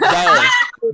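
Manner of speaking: high loud call of a name. Real, not acted. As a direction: call out to someone across the room. Calling out name.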